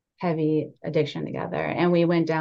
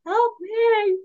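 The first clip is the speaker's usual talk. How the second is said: very high, slow pleading little voice; pleading body; slower than usual. Pretend. Body begging her to listen, tiny pleading voice. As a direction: give the body a tiny desperate voice.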